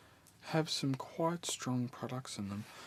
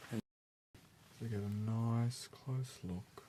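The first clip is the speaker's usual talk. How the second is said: right in your ear. Acted. Asks listener to close eyes.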